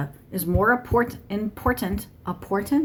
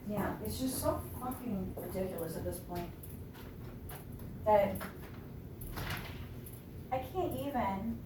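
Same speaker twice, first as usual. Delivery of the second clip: much softer, off to the side; exasperated aside; 13 dB quieter than usual. Real, not acted. Owner asking the cat in or out, quiet exasperated aside. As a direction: give up softly on an indecisive pet. Turns away to ask the cat.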